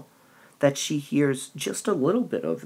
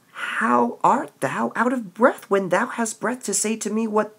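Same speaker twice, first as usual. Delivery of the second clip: higher, pressing Juliet; impatient Juliet; 7 semitones higher than usual. Acted. Teacher reads Juliet pressing the nurse for news. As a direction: demand the news urgently.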